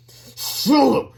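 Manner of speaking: high, loud, furious call; angry. A narrator's reading. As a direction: shout the name as a last warning. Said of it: "he said angrily".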